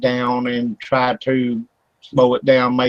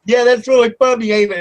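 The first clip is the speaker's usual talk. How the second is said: high, loud laughing taunt; mock agreeing laugh; 9 semitones higher, 4 dB louder, faster than usual. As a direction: egg the ghost on while laughing.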